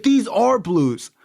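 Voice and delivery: high, slow, emphatic retort; insist, stretching out each word up high; acted insistence. Dealer insisting the pills are blues, acted insistence. Acted quarrel over pill colour.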